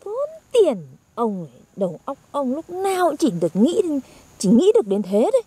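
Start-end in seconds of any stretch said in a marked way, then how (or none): 0.0-0.8 high, incredulous echoed question; high-pitched, scandalised query; indignant echo. Real, not acted. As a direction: throw it back in disbelief, pitched way up. Indignantly echoes the man's objection.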